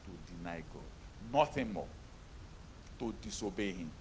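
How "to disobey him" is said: normal talking voice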